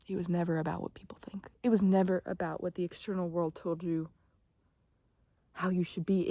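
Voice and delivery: soft, hushed whisper to the listener; intimate whisper. Acted. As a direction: whisper it like a secret truth. Whispers her message to viewer.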